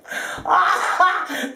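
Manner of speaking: high, open cackle; burst of laughter. Real, not acted. Laughing about calling in her pals, high cackle. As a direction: let out a real high laugh.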